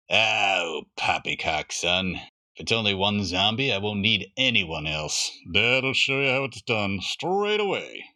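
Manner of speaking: a hint of a gruff father lecturing his son. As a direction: play it as a dad sure of himself. Father voiced in story.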